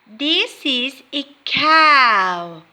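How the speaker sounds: slow, careful, each word clear; slow teacher modelling. Shown as a demonstration. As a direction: spell it out slowly for young learners. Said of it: "listen and repeat".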